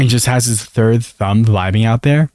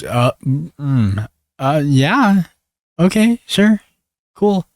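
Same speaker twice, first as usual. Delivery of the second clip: halting, higher-pitched mock reluctance; hesitant agreement; 3 semitones higher, much slower than usual. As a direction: agree in small, unsure bits. Acting Vanya's hesitant agreement.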